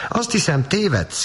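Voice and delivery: high-pitched hurt protest; offended outcry. Acted. Little sister crying out at her teasing brother, hurt. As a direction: cry out in offence. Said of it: "kiáltotta".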